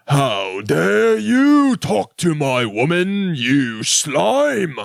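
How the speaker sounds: slow, drawn-out, hammed-up British outrage; indignant British husband. Rich husband rebuking the Cockney guy, pompous mock fury. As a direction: swoop up high in theatrical upper-class indignation. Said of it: "her husband is like".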